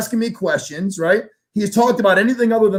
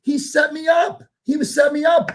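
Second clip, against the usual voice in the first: outraged shout; 10 semitones higher than usual. Retold or imitated. Outraged shout at the kid who set him up. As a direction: shout it, stunned he got played.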